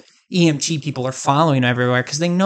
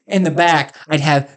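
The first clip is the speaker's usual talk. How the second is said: ordinary delivery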